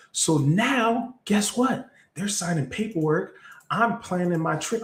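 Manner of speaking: high, eager storytelling; a rush of excitement; excited. Retold or imitated. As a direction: ride the thrill of winning. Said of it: "I was excited".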